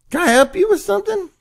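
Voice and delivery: testy high-voiced call-out. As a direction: call over to someone ignoring you, pitch jumping up. Calling out to unresponsive stranger.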